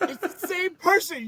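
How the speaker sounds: high-pitched comic outrage; exasperated rant. Pretend. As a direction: protest it in mock outrage. Ranting at Katara in disbelief.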